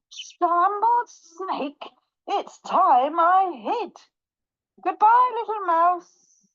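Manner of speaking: high, slow, drawn-out horror; alarmed snake. Acted. Snake panics and flees.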